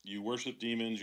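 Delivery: normal talking voice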